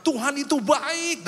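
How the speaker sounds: high, loud, stretched-out preaching call; confident proclamation. Retold or imitated. Pastor preaches while doubting inside, loud confident proclamation. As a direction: proclaim it big and slow to the congregation.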